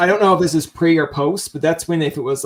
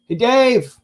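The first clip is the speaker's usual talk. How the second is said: higher, casual holler to a neighbour; calling out; 6 semitones higher, 4 dB louder than usual. Acted. Walking into a friend's house uninvited, calling out. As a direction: call into the house for Dave.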